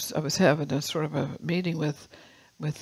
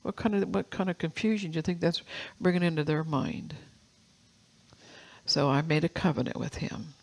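normal talking voice

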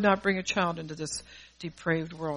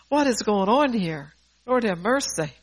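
louder, slowed mock exasperation; exasperated parent; 4 semitones higher, 6 dB louder than usual. Mother to God about what is going on, mock exasperated. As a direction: cry out in playful exasperation, drawing it out. Acted thought of an overwhelmed parent.

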